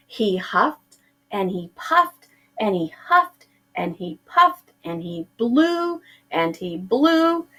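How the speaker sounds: ordinary delivery, nothing marked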